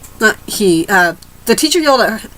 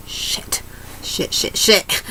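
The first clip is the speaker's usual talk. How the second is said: breathless whispered cursing, much softer; panicked whisper; 8 dB quieter, much slower than usual. Retold or imitated. Late student hearing the bell, whispering curses in panic. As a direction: hiss the curses under your breath in panic.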